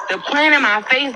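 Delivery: usual speaking voice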